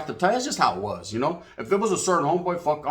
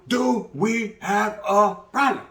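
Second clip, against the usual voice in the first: slow, higher drawn-out challenge; mock tough challenge; 6 semitones higher, much slower than usual. Storyteller voices the brother calling them out. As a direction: ham up the big brother's showdown. Big brother confronting in yard.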